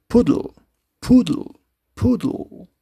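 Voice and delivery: "poodle" high on the first syllable, falling away; first-syllable stress. Shown as a demonstration. Teacher introduces the third example, stressing the first syllable. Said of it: "the accented syllable is the first".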